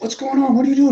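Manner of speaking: high, urgent confused questions; retelling his alarm while being beaten up; panicked pleading. Retold or imitated. Retelling panic at a changed plan.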